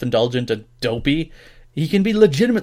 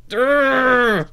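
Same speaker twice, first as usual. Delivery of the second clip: higher, dopey durr in a put-on voice; mocking dumb grunt; 10 semitones higher, 4 dB louder than usual. Podcaster mocking the announcer's pop culture pride, dopey grunt. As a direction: play the announcer as a dimwit. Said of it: "It's like".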